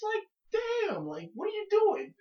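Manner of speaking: ordinary talk, nothing marked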